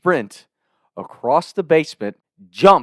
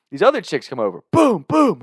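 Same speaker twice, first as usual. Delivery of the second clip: ordinary talk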